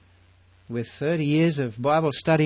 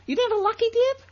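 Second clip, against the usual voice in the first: much higher, squeaky disbelief; mock astonishment; faster than usual. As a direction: play a stunned schoolkid.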